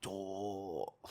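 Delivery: usual speaking voice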